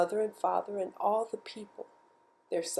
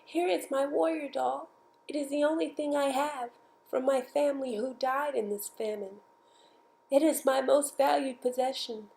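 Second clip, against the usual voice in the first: put-on little girl's voice; 6 semitones higher than usual. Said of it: "she who was alone said".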